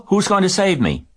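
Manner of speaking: high, loud, urgent question; squeaky young pig grasping at hope; crying out. A narrator's reading. Pig asks spider who will save him, high urgent cry. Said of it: "cried Wilbur".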